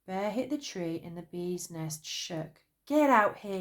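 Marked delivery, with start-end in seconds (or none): 2.9-3.6 loud angry call; loud demand. A narrator's reading. Bear, banging the tree, yells loudly at the bee. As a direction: yell it up at the nest.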